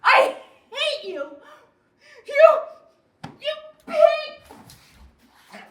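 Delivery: high, loud, broken outburst; enraged yelling. Acted. Wife hating husband at quarrel's peak, enraged yelling. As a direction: hurl each word at him with pauses between.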